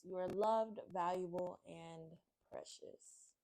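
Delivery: ordinary delivery, nothing marked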